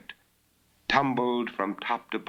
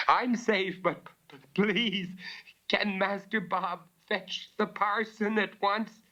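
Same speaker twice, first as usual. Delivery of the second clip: shaken plea; 12 semitones higher than usual. Acted. Shaken girl asks for parson.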